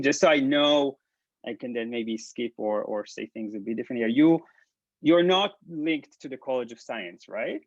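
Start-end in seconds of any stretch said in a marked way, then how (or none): none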